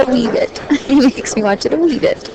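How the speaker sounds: a hint of Scottish accent on wee bit; mock Scottish accent. Wife joking about Braveheart, lightly mock Scottish accent. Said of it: "the only thing I can say in the Scottish accent".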